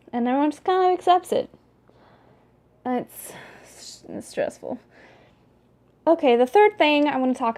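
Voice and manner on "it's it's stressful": whispered under the breath; whispered aside. Real, not acted. Admitting the dialect stresses her, whispered aside. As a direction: let it out as a tired whisper.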